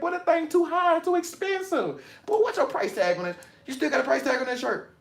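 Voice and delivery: high, whiny friend who can't afford it; high mocking voice of a friend moaning about a pricey shirt; whiny broke friend. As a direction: mimic a broke buddy griping about prices.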